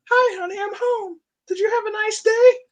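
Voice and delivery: very high mock wife voice; falsetto housewife. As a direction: squeak it like a cartoon sitcom wife. Same greeting redone in wife voice.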